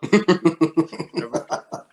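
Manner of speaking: loud, high burst of laughter; hearty laugh. Real, not acted. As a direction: laugh out loud at a teasing remark. Reaction to overpricing joke.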